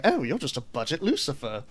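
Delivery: posh British accent, mock jibe; Theo. Shown as a demonstration. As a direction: keep up the posh British character voice. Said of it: "I can't do this voice".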